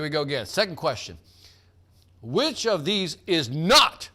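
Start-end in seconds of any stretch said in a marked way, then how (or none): 2.2-4.1 slow, with not pressed hard at the end; stress on not. Shown as a demonstration. Quiz host to players, hitting the word not. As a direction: lean on the word not, drawn out. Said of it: "I want to emphasize the word not".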